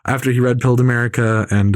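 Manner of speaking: usual speaking voice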